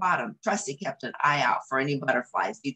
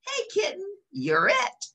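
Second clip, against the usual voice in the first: a lightly voiced playful tag call. Tramp tags the kitten in a game, playful. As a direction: invite the kitten to play.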